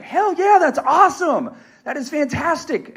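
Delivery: high, loud burst of joy; elated cheer. Retold or imitated. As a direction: react thrilled to the big news. Retold delighted reaction to pregnancy.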